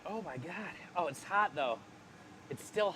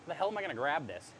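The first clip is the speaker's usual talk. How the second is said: ordinary talk, nothing marked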